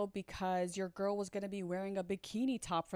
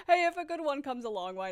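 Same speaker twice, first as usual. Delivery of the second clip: higher, louder, words riding on laughter; laughing; 8 semitones higher, 6 dB louder than usual. Real, not acted. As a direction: still giggling as you concede. Still laughing at own joke.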